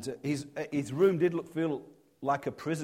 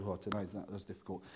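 choked-up hush; 8 semitones lower, 13 dB quieter, faster than usual. Retold or imitated. Father filling up after goodbye.

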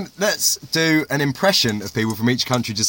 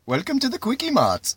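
mock Singaporean; 11 semitones higher, 4 dB quieter than usual. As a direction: pitch it way up like Apu from The Simpsons. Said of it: "I'll do Singapore".

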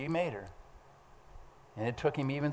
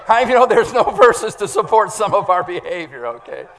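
laughing aside; 7 semitones higher, 13 dB louder than usual. Real, not acted.

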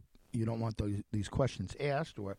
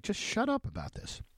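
frustrated; 9 semitones higher than usual. Retold or imitated. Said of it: "like, frustrated".

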